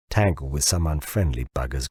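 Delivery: low, lightly growled line; a mild grumbling growl, low; understated low growl. A narrator's reading. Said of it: "growled Bill".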